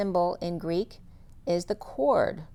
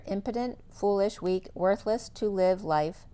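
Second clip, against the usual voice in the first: usual speaking voice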